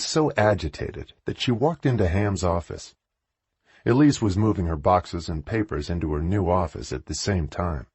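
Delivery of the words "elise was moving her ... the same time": usual speaking voice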